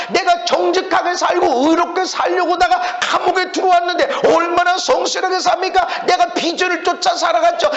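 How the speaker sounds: high-pitched, relentless protest; resentful complaining. Acted. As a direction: act a wronged prisoner appealing to God. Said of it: "원망하고 불평하고 그러지 말아라".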